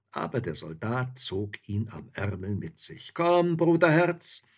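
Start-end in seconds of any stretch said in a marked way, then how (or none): 3.1-4.4 high, loud, drawn-out call; jolly soldier urging a comrade on; slow, booming invitation to a pal; hearty coaxing. A narrator's reading. Soldier coaxes hunter along.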